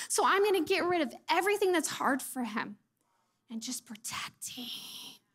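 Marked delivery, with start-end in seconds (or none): 3.5-5.2 slow, soft whisper; hushed protective whisper. Acted. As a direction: breathe it like a secret. Mama sharing a fierce secret.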